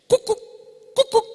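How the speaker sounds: loud imitated "cucu" bird call from afar; cuckoo call. Real cuckoo mother calling her chick from far off, loud. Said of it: "un grito le da, de allá lejos".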